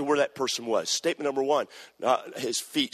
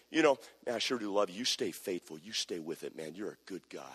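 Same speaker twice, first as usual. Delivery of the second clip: much softer and lower, tender reassurance; quiet encouragement; 8 semitones lower, 7 dB quieter than usual. Retold or imitated. Pastor encouraging a young man, soft and low. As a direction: murmur it like a private word of support. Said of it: "going up and saying".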